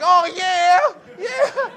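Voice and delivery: high, loud, overeager yeah; fake enthusiasm. Comedian faking excitement to interviewer, loud and high. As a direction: gush falsely to please.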